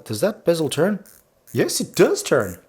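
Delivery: high and bright with delight; thrilled about the bezel; excited. Real, not acted.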